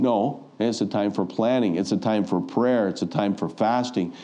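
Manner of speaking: ordinary talk, nothing marked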